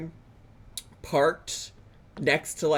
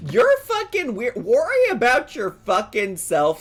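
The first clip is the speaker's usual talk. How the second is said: high, exasperated outburst; loud scolding; 7 semitones higher, 5 dB louder than usual. Real, not acted. Scolding Tim for judging strangers, exasperated. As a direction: tell him off at raised volume.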